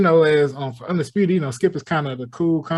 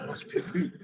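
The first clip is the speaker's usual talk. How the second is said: whispered mock request; 10 dB quieter, faster than usual.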